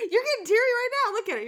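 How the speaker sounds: high, loud, fast and laughing; astonished laughing disbelief. Retold or imitated. Mother to her teary daughter, high laughing disbelief. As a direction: shriek it out in happy disbelief. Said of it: "And I said".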